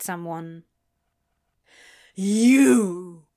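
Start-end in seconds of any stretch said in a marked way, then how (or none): 2.1-3.3 a loud accusing snarl. Acted. Crowley snarls loudly at the smug orchid. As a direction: spit the word at the plant. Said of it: "he snarled".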